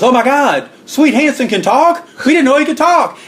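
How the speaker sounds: high-pitched, astonished announcer imitation; mock disbelief, pitched high; Charlie Harville amazed. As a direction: act stunned, voice shooting up. Announcer amazed Swede can talk.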